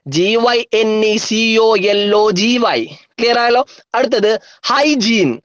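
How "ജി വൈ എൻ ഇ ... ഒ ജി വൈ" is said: letters run together without gaps; quicker, joined-up spelling chant; speedy spelling. Shown as a demonstration. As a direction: rattle off the spelling in one go. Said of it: "ഒന്നുകൂടെ സ്പീഡില്".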